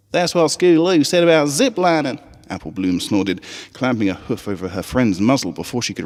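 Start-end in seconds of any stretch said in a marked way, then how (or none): 0.1-2.2 much higher, Southern twang, eager; high country filly voice. Acted. Apple Bloom rallying the Crusaders on the school trip, high Southern twang.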